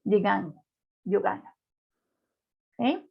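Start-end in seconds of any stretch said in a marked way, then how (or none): 0.1-0.5 "Je gagne" with GN said as Spanish Ñ; "Je gagne" with one ny consonant. Shown as a demonstration. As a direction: end "Je gagne" on a ny sound. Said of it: "da el sonido de la Ñ".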